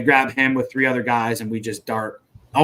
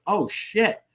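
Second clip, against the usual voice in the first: high-pitched imitated exclamation; retold realization; 9 semitones higher than usual. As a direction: re-enact the moment it dawns on you. Retells sudden realization.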